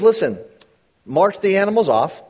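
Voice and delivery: scripture reading. Acted. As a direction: read the verses out with weight. Reads scripture aloud.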